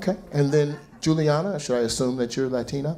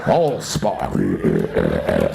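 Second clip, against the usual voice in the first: much lower booming robot voice; deep robot voice. Pretend. Comic voicing her as a Transformer. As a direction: drop to a giant robot's boom.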